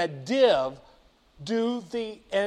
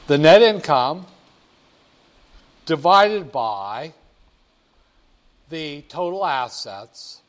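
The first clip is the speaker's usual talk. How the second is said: dictating; 6 dB louder than usual. Shown as a demonstration. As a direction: spell out each part of the formula. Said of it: "just do that function".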